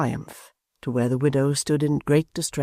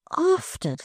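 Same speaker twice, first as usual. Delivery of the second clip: high startled cry of often; Barnaby yelps the word in disbelief; high astonished exclamation; cried out. Acted. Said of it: "cried Barnaby".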